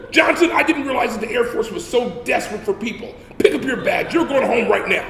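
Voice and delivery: mock outrage at the new recruit; sergeant yelling. Drill sergeant berates recruit.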